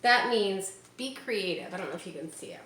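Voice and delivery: ordinary delivery, nothing marked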